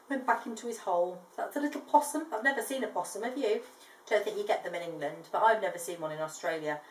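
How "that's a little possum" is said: usual speaking voice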